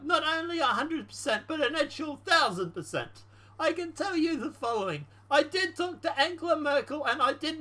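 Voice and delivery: Ukrainian president role. Acted. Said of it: "So the Ukrainian president responds".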